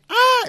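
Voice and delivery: high, loud shriek of panic; acted-out scream at a wasp; sudden scream. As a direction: let out a startled, high-pitched yell. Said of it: "Empezó a gritar".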